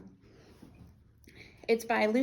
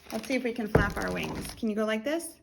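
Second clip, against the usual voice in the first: plain reading voice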